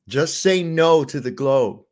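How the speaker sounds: slow, hammed-up public service warning; mock announcer warning kids, slowly; mock PSA slogan. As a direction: preach it like an anti-drug ad.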